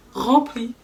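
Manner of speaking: a burst of disbelief at the full cart; shocked emphasis. Retold or imitated. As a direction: stress the outrage on rempli. Shocked at his full shopping cart.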